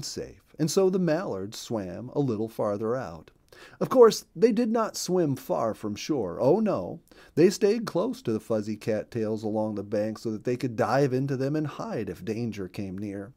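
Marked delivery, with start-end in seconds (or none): none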